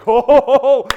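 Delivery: loud, high run of "ho"s; delighted whoop. Acted. Jesus reacting to the woman's bold answer, delighted whooping. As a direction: whoop as if bowled over.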